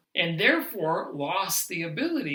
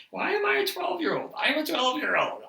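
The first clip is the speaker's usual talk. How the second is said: giggly self-mockery; 11 semitones higher, faster than usual. Real, not acted. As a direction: squeal it out, embarrassed by your own giggles. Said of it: "I can't even say hematochezia without laughing".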